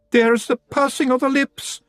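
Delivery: high, slow Scots shout; a frightened pixie pointing out doom; panicked shout. A narrator's reading. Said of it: "a Feagle shouted".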